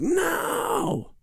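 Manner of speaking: imitating his toddler's high, shrill shriek of no; angry toddler. Retelling his angry three-year-old son, high toddler shriek. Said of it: "when my three-year-old gets angry".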